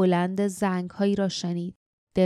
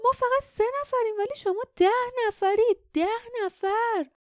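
much higher voiced warning cry; young woman crying out to guards; girl shouting. A narrator's reading. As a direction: call it out as the young heroine, high. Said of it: "جاسمین فریاد زد".

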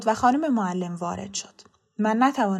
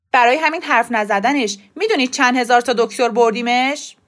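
indignant protest; 3 semitones higher, 8 dB louder than usual. A narrator's reading. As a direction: protest forcefully, defending yourself. Father argues with school principal.

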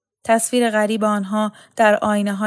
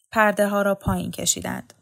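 plain reading voice

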